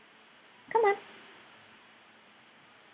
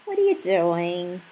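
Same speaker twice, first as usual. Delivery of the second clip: usual speaking voice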